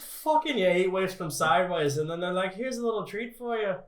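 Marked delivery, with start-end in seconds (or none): 2.5-3.8 light mock British accent, pitched higher; hammed-up British accent. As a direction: offer the little treat in a playful British accent. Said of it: "I had to go British for that".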